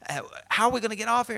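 high, frantic castaway rattling off worries; freaking out. Acted. Castaway urging his sunbathing companion to act, freaking out. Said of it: "The other dude was freaking out".

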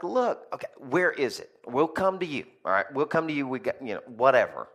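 pushing hard to get the rock delivered; retold angry call. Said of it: "I was angry".

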